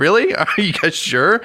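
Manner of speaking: squeaky and slow, laughing through it; high laughing mock plea. Teasing visitors leaving the door, high laughing plea. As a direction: beg them to stay, cracking up.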